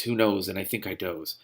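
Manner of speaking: ordinary talk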